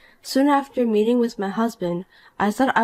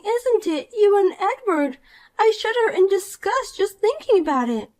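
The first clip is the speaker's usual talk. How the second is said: put-on a higher, bratty young woman in disbelief; Poppy, indignant; 10 semitones higher than usual. Rival wife-hunter scoffs at the married woman, higher and bratty. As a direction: play the spoiled rival who refuses to believe it.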